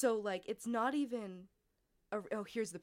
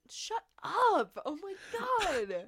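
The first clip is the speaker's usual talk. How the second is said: embarrassed shout; 11 semitones higher, 6 dB louder, much slower than usual. Real, not acted. Embarrassed at friend's teasing.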